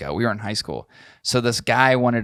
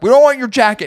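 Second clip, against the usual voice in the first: loud, high mock yell; retold shouted brush-off; exasperated group refusal; 12 semitones higher, 8 dB louder, faster than usual. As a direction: shout him down in comic exasperation. Group refusing the pushy seller.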